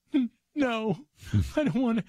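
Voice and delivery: mock-childish I-don't-want-to whine; whiny inner voice. Speaker recalls not wanting to help the worker, high whiny voice. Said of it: "And I thought".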